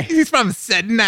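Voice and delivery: high, drawn-out mock Australian; bad Australian accent. Co-host saying where he's from, bad Australian accent. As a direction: stretch it out in a silly Australian accent. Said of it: "my bad Australian accent".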